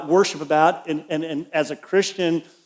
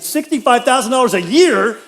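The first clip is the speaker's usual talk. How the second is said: astonished echo; 6 semitones higher, 5 dB louder than usual. Retold or imitated. Astonished at huge property tax.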